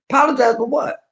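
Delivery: retold outraged comeback; indignant disbelief. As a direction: scoff at the idea of apologizing. Retold indignant reply to sister.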